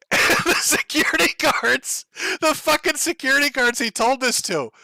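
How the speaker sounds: high, squeezed out through real laughter; laughing while talking. Real, not acted. Streamer cracking up over security guards in his story, laughing while talking. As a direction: crack up mid-sentence, pitch shooting up.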